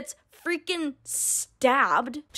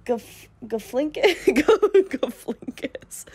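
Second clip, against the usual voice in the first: slow stumble breaking into laughter; real laughing; 2 semitones higher, slower than usual. Real, not acted. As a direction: lose it laughing mid-name. Laughs while fumbling a name.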